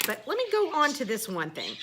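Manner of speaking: normal talking voice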